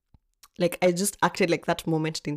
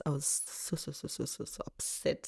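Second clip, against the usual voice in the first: whispered upset; 2 semitones lower, 9 dB quieter than usual. Real, not acted. Retelling how upset she was.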